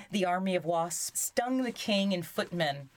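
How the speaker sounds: plain reading voice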